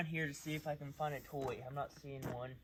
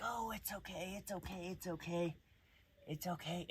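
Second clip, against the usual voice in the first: mock soothing coo, higher and softer; soothing owner; 6 semitones higher, 7 dB quieter than usual. Dog trainer mimicking a coddling owner, soft cooing. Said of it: "the owner would be like".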